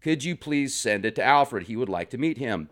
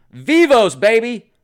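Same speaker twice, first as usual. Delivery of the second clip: excited cheer; 9 semitones higher, 9 dB louder than usual. Real, not acted. Cheering Milton's bunker comment with a loud excited shout.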